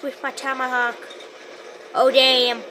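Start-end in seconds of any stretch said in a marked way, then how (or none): none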